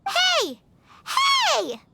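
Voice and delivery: a high shout after someone leaving; calling out. Acted. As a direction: yell to stop her walking off. Paimon calls after leaving Margaret.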